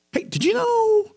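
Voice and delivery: very high, drawn-out mock gossip squeal. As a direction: parody a busybody, way up high and slow. Mimics an eager gossip starting up.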